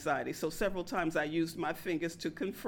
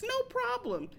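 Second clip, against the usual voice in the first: much higher, put-on voice; mock sweet echo. Narrator mocking her own polite reply, much higher. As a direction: chirp "No problem" way up high. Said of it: "mock echo of customer".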